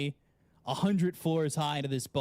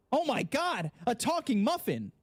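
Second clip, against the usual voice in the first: surprised muffin; 9 semitones higher than usual. Acted.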